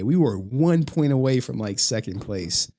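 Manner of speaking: usual speaking voice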